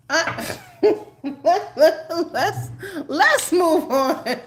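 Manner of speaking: slow, high, laughing through the words. Real, not acted. Reader laughing at the Magician card, slow high giggle. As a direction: giggle while pushing on.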